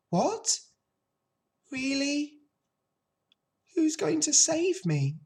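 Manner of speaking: high, thin-pitched piglet, astonished; hopeful little pig. A narrator's reading. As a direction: ask in amazed hope as the pig. Pig hears he may live.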